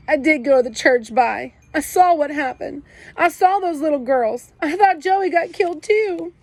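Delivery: high, loud tearful confession, slowing at the end; choked up. A narrator's reading. Kenny confesses to Byron, high and choked with tears. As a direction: force the words past a lump in the throat. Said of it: "Adam's apple felt like it was going to blow a hole in my throat".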